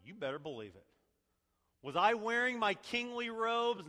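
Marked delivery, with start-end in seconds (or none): 1.8-3.9 much higher and louder challenge; David, defiant rhetoric. Retold or imitated. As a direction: throw the question back at her. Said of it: "David's saying".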